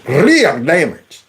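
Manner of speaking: "Real damage" drawn out in mock Indian accent; mimicked Indian accent. Host echoing congressman's "Real damage", exaggerated Indian accent. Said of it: "understand the heavy accent".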